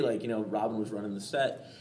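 ordinary talk, nothing marked

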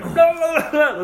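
hammed-up tongues babble; mock tongues babble. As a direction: gabble high and loud.

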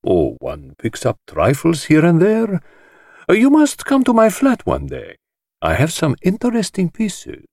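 high, airy dismissive host; Chaitana voice. A narrator's reading. Chaitana invites Poirot to his flat, airy and high. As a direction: brush it off with sly airiness. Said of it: "waved a deprecating hand".

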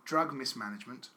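low, measured reading aloud; letter reading. Acted. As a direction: read a solemn confessional letter, deadpan. Reads out a letter.

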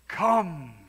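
one word, barked out loud as a command. Acted. Jesus calling Peter from the storm, loud command. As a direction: call it across the raging sea. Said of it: "a command".

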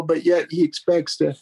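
ordinary talk, nothing marked

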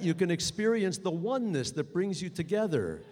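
normal talking voice

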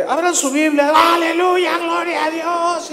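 high shouted cries of praise; rousing shout. Retold or imitated. The preacher calling out to open their Bibles, shouting high. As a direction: whip up the crowd between words. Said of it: "Y bueno, este".

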